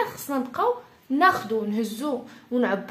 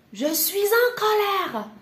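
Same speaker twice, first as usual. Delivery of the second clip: high-pitched mock outburst; hammed-up anger; 8 semitones higher than usual. Shown as a demonstration. French teacher acting out being in anger, high and indignant. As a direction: throw an exaggerated fit of temper, pitched high. Heightened take of anger phrase.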